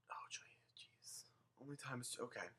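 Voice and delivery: breathy aside under her breath; whisper. Real, not acted. Whispering to herself while ranting to viewers.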